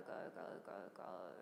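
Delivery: voiceless breathy go on the out-breath; airy, voiceless letting go; whispered release. Shown as a demonstration. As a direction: breathe the words out as you exhale. Exhaling all the breath.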